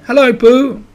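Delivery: high, loud, happy greeting; joyful shout. A narrator's reading. Answering a friend's hello, joyful shout. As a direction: call out gladly from afar. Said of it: "he shouted joyfully".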